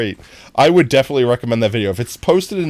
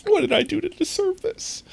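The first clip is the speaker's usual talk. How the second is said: much higher, squeaky mock despair; high mock lament; 6 dB quieter than usual. Streamer repeating the question to chat, pitched high in mock despair. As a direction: ask it again in a tiny high wail. Same line said twice.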